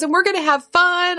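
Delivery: put-on high, eager child voice; excited little girl. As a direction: bounce with a kid's excitement. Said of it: "she goes".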